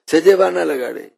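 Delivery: ordinary talk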